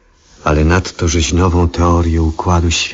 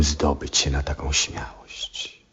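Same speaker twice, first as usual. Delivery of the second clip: whispered to himself in wonder; hushed wonder at his own nerve; awed whisper; 2 semitones lower, 10 dB quieter than usual. Acted. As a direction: let it fall to a whisper. Marvels at his own daring.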